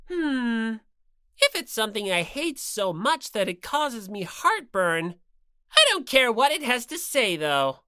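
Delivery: lightly voiced bossy bear mascot; Monokuma voice. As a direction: lecture her with smug cruelty. Monokuma dismissive.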